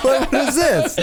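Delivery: laughing. Real, not acted. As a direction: laugh while you ask it. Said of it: "You were doing the robot?".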